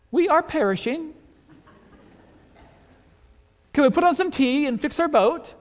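mock-British politeness. Disciples politely ask for tea and boat repair, mock British. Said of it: "unless they were British".

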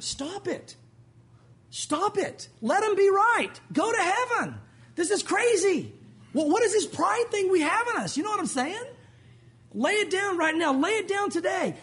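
high-pitched, impassioned preacher's exhortation; exasperated pleading. Real, not acted. Preacher exasperated, urging against pride.

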